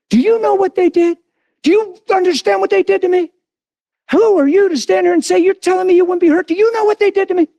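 high-pitched offended believer; wounded, insistent challenge, acted out; acted indignant protest. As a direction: demand understanding in mock outrage. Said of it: "this whole list is people's justification".

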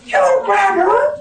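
high, loud preacher's cry with laughs; full-force preaching. Acted. Pastor telling the church about the girl, preaching at full force. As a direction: preach it out to the rafters.

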